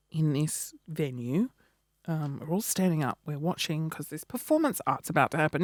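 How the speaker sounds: soft conspiratorial whisper; hushed whisper. Pretend. Hushed scene setting, family front row.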